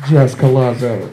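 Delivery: host introducing the next comic; emcee introduction. Real, not acted. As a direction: bring her on like a host. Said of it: "Ladies and gentlemen".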